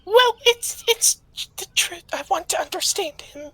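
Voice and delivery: loud, squealed self-defence; high-pitched protest. Acted. Character protests being called mean.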